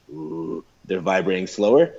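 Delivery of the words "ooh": low, soft "ooh"; low, quiet sustained vowel; lower pitch. Shown as a demonstration. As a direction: demonstrate a low note on "ooh". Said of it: "and a lower pitch".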